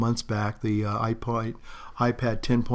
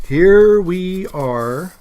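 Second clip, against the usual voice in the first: high, loud, very drawn-out reveal; big slow ta-da delivery; showy reveal; 7 semitones higher, 8 dB louder, much slower than usual. Pretend. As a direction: stretch it out like a grand unveiling. Grand reveal of the shoes.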